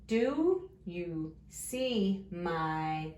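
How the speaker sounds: very slow, each word stretched; drawn-out early-reader pacing; word-by-word reading. Shown as a demonstration. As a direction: read along slowly, one word at a time. Sounding out a sentence word by word.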